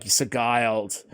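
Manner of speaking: "Sigiled" drawn out slowly as suh-GUY-ld; mistaken pronunciation relished. Retold or imitated. Host recalling his cool word, Sigiled slowly as suh-GUY-ld. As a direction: stretch "Sigiled" out, hard g and long i. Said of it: "but I was like".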